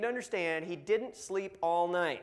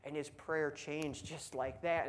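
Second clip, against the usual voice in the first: normal talking voice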